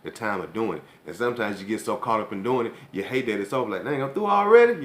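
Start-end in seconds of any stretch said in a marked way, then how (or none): 3.9-4.8 high, loud dismayed outburst; disappointed exclaim. Retold or imitated. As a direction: blurt it out, sorry it ended so soon. Acted quote, sorry it's over.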